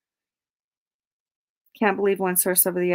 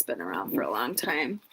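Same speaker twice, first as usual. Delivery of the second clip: a hushed, unvoiced aside; whisper; 9 semitones lower, 9 dB quieter than usual. Real, not acted. Streamer whispering a secret about Spotted Elephant to the chat, hushed.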